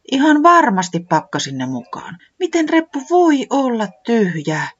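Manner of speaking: can't believe it, pitch climbing; disbelieving säämies. Acted. Säämies staring at the empty bag, high and dismayed.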